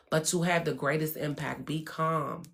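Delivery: normal talking voice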